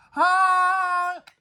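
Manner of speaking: loud, high honking horn sound; car horn honk. A narrator's reading. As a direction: blare it like a horn.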